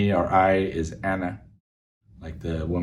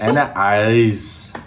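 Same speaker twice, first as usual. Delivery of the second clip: slow, heavy Austrian accent like Arnold; Arnold Schwarzenegger accent; 5 dB louder, much slower than usual. Shown as a demonstration. Learner demos Arnold accent for Arabic phrase. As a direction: growl "Ana eyes" like the Terminator.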